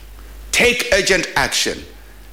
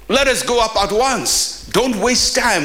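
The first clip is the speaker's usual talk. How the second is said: higher, urgent rallying cry; urgent call; 6 semitones higher than usual. Retold or imitated. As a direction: push the crowd to move now. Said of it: "Caleb told the people".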